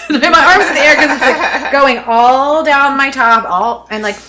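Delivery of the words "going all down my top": ordinary delivery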